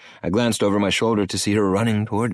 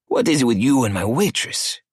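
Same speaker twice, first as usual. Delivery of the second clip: a lightly voiced cocky older man, pitched up; arrogant cartel boss; 6 semitones higher, 4 dB louder than usual. Cartel boss Emilio needling Maddie on the phone, sneering. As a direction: sneer the question at him.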